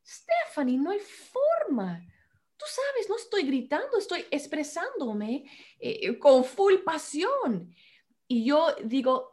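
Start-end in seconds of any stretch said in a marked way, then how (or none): 0.0-7.7 impassioned husband, high and drawn out; Daniel. Retold or imitated. Wife imitates husband insisting he isn't yelling, high-pitched protest. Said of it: "Daniel dice".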